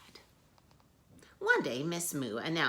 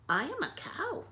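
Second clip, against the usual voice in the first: much higher, timid cow; 3 dB louder than usual. Acted. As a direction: squeak it like a small cow. Miss Moo answers.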